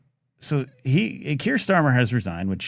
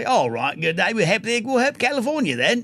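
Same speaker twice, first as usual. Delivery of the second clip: lightly put-on British accent, a bit higher; mock British accent; 10 semitones higher than usual. Ken imitating the Brit candidate, light British accent. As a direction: toff offering help, hammed-up. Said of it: "we got this guy".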